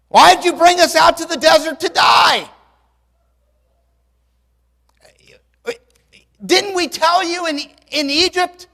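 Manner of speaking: high, loud mocking yell at Moses; frightened crowd shouting sarcasm at Moses; sarcastic angry shout. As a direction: rail at him with bitter sarcasm. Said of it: "the gallows humor, the sarcasm".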